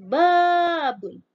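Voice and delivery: babun with ba held long and loud; drawn-out long vowel. Shown as a demonstration. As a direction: stretch the ba in babun. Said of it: "yang panjang adalah ba-nya".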